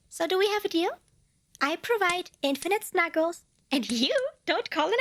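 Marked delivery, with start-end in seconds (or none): none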